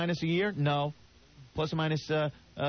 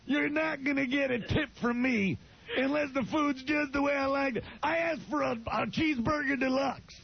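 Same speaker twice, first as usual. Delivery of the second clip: Don West impression; 5 semitones higher than usual. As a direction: bellow complaints like a cranky shopping-channel host. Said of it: "Don West yelling".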